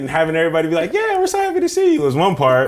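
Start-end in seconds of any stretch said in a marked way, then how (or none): 0.9-2.0 much higher, sing-song fan welcome; mock cheerful fans. Rapper mimicking fans cheering for him, mock chirpy high welcome. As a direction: chirp it like excited fans greeting you.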